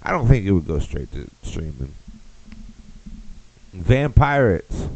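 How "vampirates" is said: a drawn-out, slightly higher trailer read; trailer title. Acted. Comic repeating the fake movie title, slow trailer voice. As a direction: sell the title slowly.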